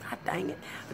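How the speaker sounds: hushed whisper. Retold or imitated. Cursing under her breath after falling off.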